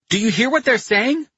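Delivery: high-pitched yelled question; excited yell. A narrator's reading. Carletto yelling to Pino about the radio news, excited yell. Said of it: "Carletto yelled".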